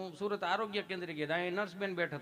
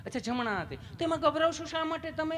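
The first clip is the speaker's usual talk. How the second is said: much higher, cooing mock nurse; sweet nurse voice; 10 semitones higher than usual. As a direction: coo at the patient like a nurse. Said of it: "પ્રેમથી પૂછો છો".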